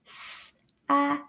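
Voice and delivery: "S-A-C" as three separate sounds with gaps; sounded-out letters. Shown as a demonstration. Teacher showing the A sound inside the word, segmented slowly. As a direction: sound out "S-A-C" one phoneme at a time. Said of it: "sac is also an A sound word".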